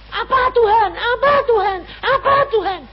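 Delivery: high, whiny repeated plea; mock pestering. Worried believer pestering God for answers, high whiny mock. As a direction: nag God like a child.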